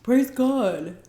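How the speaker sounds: hammed-up fake joy; fake-happy husband. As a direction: mimic his fake enthusiasm. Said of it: "he was like".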